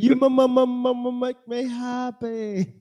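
high, slow stammering voice; Simple Jack impression. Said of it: "where you have Simple Jack".

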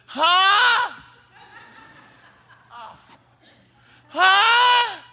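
loud, high, drawn-out "huh"; father calling back. Retold or imitated. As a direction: holler it as a distracted dad. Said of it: "looks outside and says".

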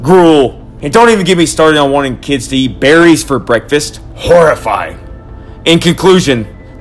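loud, worked-up tirade; ranting. Acted. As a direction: rant like a crazed conspiracy man. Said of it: "the Lucky Charms rant".

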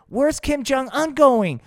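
high, loud and slow; kids' host excitement; excited. Pretend. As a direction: ask it in wide-eyed suspense.

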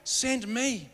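eager volunteer. Retold or imitated. Isaiah volunteers to God, high eager call. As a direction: shoot your hand up, pick me. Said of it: "Isaiah ... goes".

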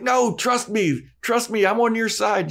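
hammed-up high pleading vampire; mock Angel pleading. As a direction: beg her to trust him, high. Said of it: "He's like".

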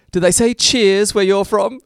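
high, loud, slow quoted line; acted stage line. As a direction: deliver it slowly and loudly, like a stage punchline. Acts out line from the play.

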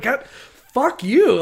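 high, loud exasperated curse; hammed-up yell at an annoying brother; mock outrage. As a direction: burst out in mock fury. Acting out brothers cursing Joseph.

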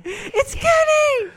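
high, slow crying shout; starstruck shout. Retold or imitated. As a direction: wail it like an overwhelmed fan.

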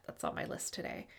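whispered, under the breath; whispered aside. Real, not acted. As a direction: murmur it as a quick aside. Unvoiced aside.

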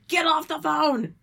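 loud, high, slow shout; yelling. Real, not acted. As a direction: yell at the screen. Yelling at character again.

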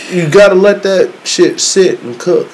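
normal talking voice

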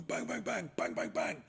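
loud, rapid bang-bang noises; mock machine-gun shouts; imitated gunfire. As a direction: play at shooting a gun, loudly. Imitating firing a gun in dream.

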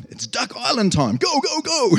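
high, loud hurry-up call; excited shout. Retold or imitated. Preacher voicing the shepherds rushing off, loud excited shout. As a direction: urge everyone to go right now.

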